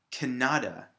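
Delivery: "Canada" said as kuh-NAH-duh; stress on middle syllable. Shown as a demonstration. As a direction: move the weight of "Canada" onto the middle syllable. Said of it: "more of an emphasis or a strength".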